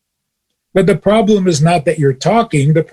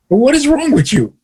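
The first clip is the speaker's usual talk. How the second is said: high-pitched, exasperated rebuke; incredulous scolding; 9 semitones higher than usual. Acted. Teacher scolding the suspicious friend, incredulous. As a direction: scold in disbelief, pitch leaping up.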